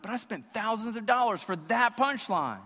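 high, loud comic outburst; hammed-up indignant protest, voice leaping up; mock exasperation. Let-down trip payer protesting a refusal, mock exasperation. Acted outraged reply to a no.

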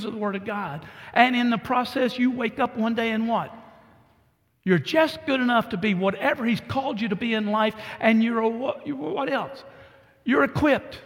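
full-force preaching. Acted. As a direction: drive the message home to the congregation.

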